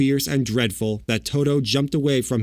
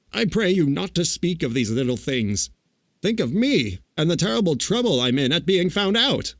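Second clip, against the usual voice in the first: higher, lightly voiced pleading Oz; flustered little man; 7 semitones higher than usual. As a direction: plead for some sympathy for your trouble.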